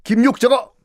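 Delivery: mock fuming, rising at the end; furious king. King Hyojong fuming at Kim Yuk, mock anger. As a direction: sputter with half-acted rage. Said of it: "효종이 격분합니다".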